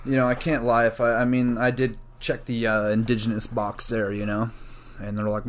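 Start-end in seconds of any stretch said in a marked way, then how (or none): none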